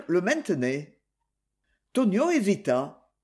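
plain reading voice

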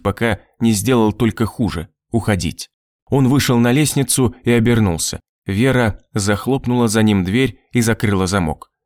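usual speaking voice

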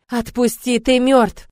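high, loud plea to be released; desperate shouting. A narrator's reading. Captive girl struggles against the dead arch, shouting. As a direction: cry out while fighting him off.

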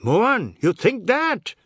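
high, loud, outraged challenge; furious question shooting up in pitch; angry scream. A narrator's reading. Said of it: "At Jagdad's scream".

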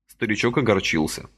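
usual speaking voice

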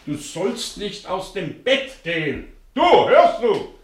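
hoch und laut, drängend auf ihn einreden; hält ihn fest und beschwört ihn; erregtes Zureden. Acted. Hält Fieberkranken im Bett fest. (hoch und laut beschwörend)

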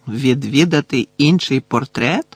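usual speaking voice

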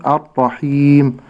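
الرحيم with a long stretched madd before the final stop; slow tajweed recitation. Shown as a demonstration. Sheikh modelling the madd for the host, slowly. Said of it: "والمد العارض السكون".

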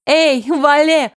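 loud, high, drawn-out call; shout across street. A narrator's reading. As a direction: holler a greeting to someone far off. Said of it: "крикнула я на всю улицу". (крик на всю улицу)

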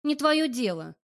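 loud, hurt retort; offended. A narrator's reading. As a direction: snap back, offended. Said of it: "Обиженно бросаю ему".

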